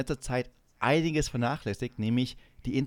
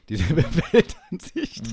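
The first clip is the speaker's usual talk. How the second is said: laughing tease; 5 semitones higher, 7 dB louder, slower than usual. Real, not acted. Teasing with a laugh.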